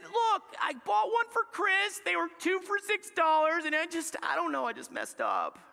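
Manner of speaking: high-pitched, pleading self-justification; hammed-up high, protesting voice; defensive excuse. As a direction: plead your case like you've been caught. Caught dad making excuses.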